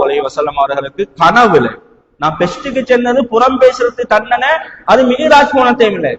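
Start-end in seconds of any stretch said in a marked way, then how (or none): none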